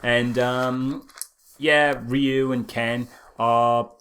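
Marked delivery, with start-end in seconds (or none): none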